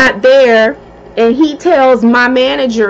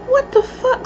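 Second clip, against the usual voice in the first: stunned disbelief; 11 semitones higher, 6 dB quieter than usual. Retold or imitated. Retold stunned inner reaction.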